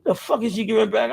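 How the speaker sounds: ordinary talk, nothing marked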